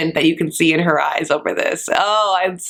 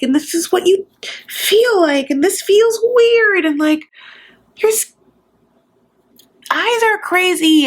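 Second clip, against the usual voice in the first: much higher, breathless wonder at every detail; mock starstruck gush; 12 semitones higher than usual. Akini meeting Benjamin at the airport, giddy high gush. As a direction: gush like meeting a celebrity face to face. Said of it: "they're like".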